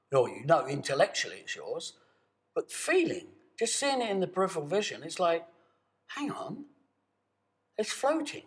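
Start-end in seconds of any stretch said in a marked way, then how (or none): 6.1-8.4 surprise. Acted. Sudden realization, high pitch.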